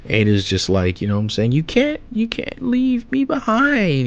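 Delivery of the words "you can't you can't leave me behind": high, slow, whiny plea; pleading girl voice. Retold or imitated. Fan mocks Ada begging Kawaki not to leave her, in a high whiny voice. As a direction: beg not to be left behind in a high voice.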